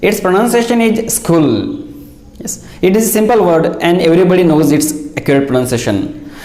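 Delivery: ordinary delivery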